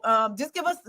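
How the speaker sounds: normal talking voice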